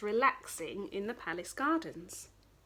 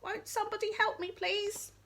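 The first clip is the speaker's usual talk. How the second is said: high, fast, pleading little frog; small croaky plea from the bushes; 12 semitones higher than usual. Acted. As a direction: squeak it out like a tiny frog begging. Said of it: "a croaky little voice called out".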